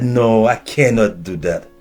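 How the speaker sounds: ordinary talk, nothing marked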